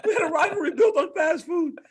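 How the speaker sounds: high, loud laughing outburst; laughing exclamation. Real, not acted. Fighter tickled by fast-food rivalry, laughing loudly.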